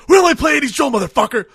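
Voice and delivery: high, loud, fast hammed-up yell; furious shout. The movie as the band, yelling at the crowd. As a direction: scream them off the stage. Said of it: "the movie going like".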